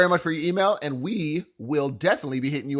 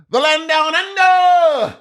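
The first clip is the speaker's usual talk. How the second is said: dramatic announcer; 11 semitones higher, 6 dB louder, much slower than usual. Pretend. Mock dramatic tourism phrase.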